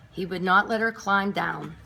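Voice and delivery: plain reading voice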